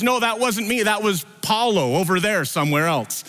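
lightly voiced Peter brushing it off; a hint of a nervous excuse; flustered denial. Peter denies a third time.

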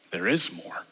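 hushed whispered promise. Acted. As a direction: breathe it out as a secret. Answer after the seeker's plea.

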